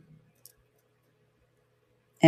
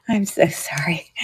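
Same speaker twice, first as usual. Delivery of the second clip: breathless laughing whisper, much softer; whispered apology; 3 semitones lower, 10 dB quieter than usual. Real, not acted. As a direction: whisper it through a giggle, embarrassed.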